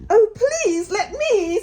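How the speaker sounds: high, slow, eager begging with a laugh; eager plea. A narrator's reading. Mole begging the Rat to pack the basket, eager and high. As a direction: plead brightly like a keen child. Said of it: "said the Mole".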